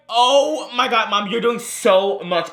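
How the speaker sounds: high, drawn-out mock dismay; slow, hammed-up exasperated son; retold exasperation. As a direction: stretch it out high and slow at mom.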